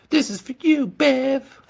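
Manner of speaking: very high, loud dedication to Bev; high women's voice. A narrator's reading. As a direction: sing it out high and bright.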